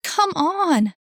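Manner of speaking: high, loud yell. A narrator's reading. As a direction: scream it at the screen. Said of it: "yelled Annie".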